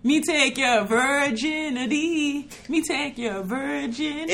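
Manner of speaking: slow mock Jamaican patois; Jamaican accent. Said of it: "was that a [name] song".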